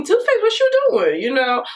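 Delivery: calling out the brand, disbelieving; exasperated callout. Acted. Calling out brand over price.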